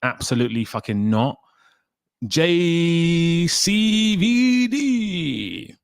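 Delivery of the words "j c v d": very slow, rising high then falling; drawn-out name call. Real, not acted. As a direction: stretch each letter out, climbing high. Greets a chat member, stretched out.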